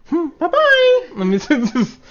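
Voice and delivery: put-on high, slow crying kid voice; a bawling little kid waving bye-bye. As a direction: whimper it like a small child.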